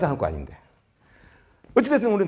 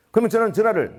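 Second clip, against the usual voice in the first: ordinary talk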